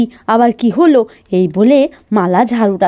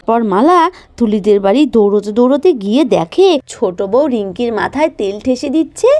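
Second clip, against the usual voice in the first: usual speaking voice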